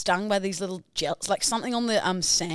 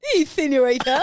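playful senorita; 6 semitones higher, 6 dB louder than usual. Pretend. Playful put-on greeting.

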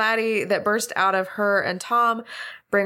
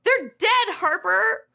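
exasperated; 11 semitones higher, slower than usual. Acted. Exasperated shout at friend.

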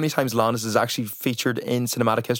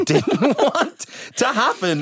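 real laughter breaking through, higher pitch; laughing; 12 semitones higher, 3 dB louder, slower than usual. Real, not acted. Cohost retelling toilet roll kick, laughing.

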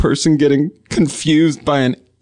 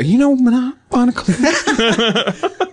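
high, mock-coy mimicry; hesitant high-voiced imitation with a laugh; sneaky asking; 12 semitones higher than usual. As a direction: tiptoe up to the name, pitched way up. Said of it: "that's such a sneaky way".

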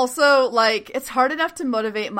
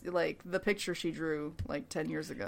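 ASMR voice; 4 semitones lower, 13 dB quieter than usual. Pretend. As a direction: lull it out like an ASMR video. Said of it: "in an A S M R voice".

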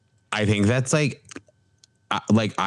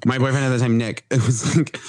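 normal talking voice

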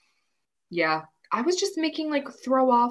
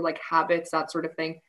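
ordinary talk

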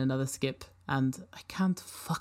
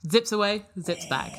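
higher, louder robot chirp; excited robot announcer; 7 semitones higher, 5 dB louder than usual. Acted. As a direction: announce the name like an eager machine. Robot voice fetching records.

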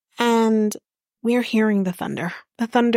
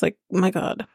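normal talking voice

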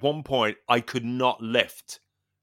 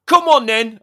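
loud, high "come on then" like a gym coach; coach's call; 11 semitones higher, 10 dB louder than usual. Retold or imitated. Trainer urging him on during knee exercises, loud and high. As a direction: bark it out as the trainer.

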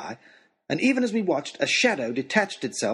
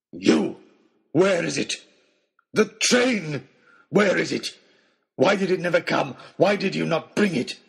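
higher, rough, ranting demands; wild angry hunter; 4 semitones higher, 4 dB louder than usual. A narrator's reading. Oxshott demands the train from Nicholas, angrily. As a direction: fire accusing questions in harsh bursts.

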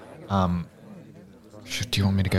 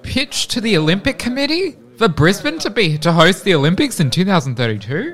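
high, disbelieving question; incredulous; 11 semitones higher, 4 dB louder than usual. Acted. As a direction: repeat it in shocked disbelief. Incredulous echo of the plan.

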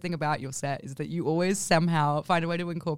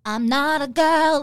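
mock Britney; 8 semitones higher, 6 dB louder, slower than usual. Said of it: "she was like".

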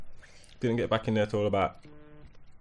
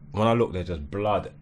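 ordinary talk, nothing marked

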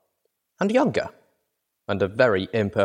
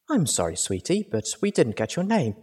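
plain reading voice

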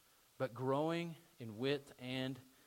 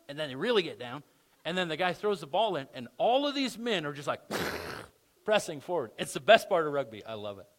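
ordinary talk, nothing marked

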